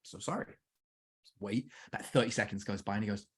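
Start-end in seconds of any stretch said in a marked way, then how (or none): 0.0-0.5 mock hushed apology. Dad apologising to meditating son.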